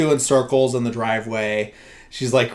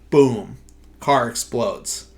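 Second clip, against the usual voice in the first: ordinary talk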